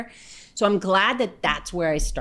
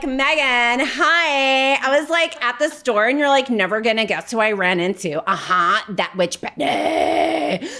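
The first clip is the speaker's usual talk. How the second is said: hammed-up California valley-girl talk, pitched up at the peaks; Californian dialect impression; 3 semitones higher than usual. New Yorker mimicking two bickering California girls, exaggerated valley-girl dialect. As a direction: play a gossiping California girl, full of "like". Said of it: "get used to their dialect".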